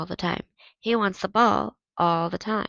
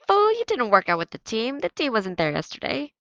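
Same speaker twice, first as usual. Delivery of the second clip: scornful; 4 semitones higher, 6 dB louder than usual. Acted. Victor calls out the lie.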